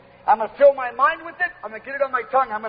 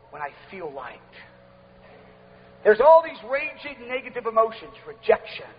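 ordinary talk

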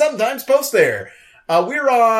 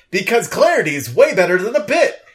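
normal talking voice

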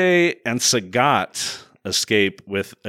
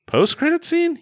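high squeaky rising question; sudden high-pitched disbelief; surprised question. Shown as a demonstration.

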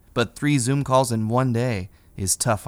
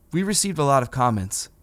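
usual speaking voice

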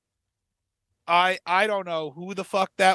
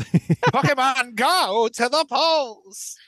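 very slow, drawn-out politician impression; hammed-up slow campaign line; Hillary Clinton; much slower than usual. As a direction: stretch the slogan out with swooping pitch. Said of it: "that was close to my Hillary Clinton".

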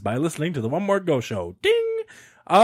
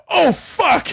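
shocked. Retold or imitated. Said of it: "And he's like".

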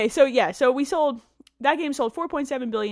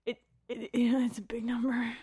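hushed awed whisper; 2 semitones higher, 5 dB quieter than usual. Real, not acted. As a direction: breathe it out in awe. Awed whisper about number.

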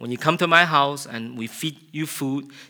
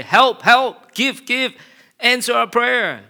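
much higher, louder mock begging; mock needy pleading; 12 semitones higher, 10 dB louder, slower than usual. Preacher mocking people who only beg God, high loud plea. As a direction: parody a needy prayer.

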